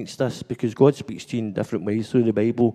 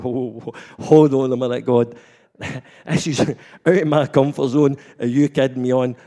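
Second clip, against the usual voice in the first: louder mock alarm at an odd request; startled protest; 2 semitones higher, 6 dB louder than usual. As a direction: blurt it out in alarm, half laughing. Startled reply to God.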